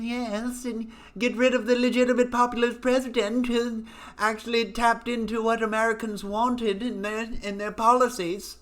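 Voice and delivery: high, sneering mock voice; mocking media boss. Mocking the media bosses ordering staff to remove Trump, high sneer.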